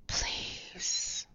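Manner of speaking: soft breathy plea in his ear; hushed pleading please; pleading whisper. A narrator's reading. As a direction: whisper it, begging. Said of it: "pleaded in her ear".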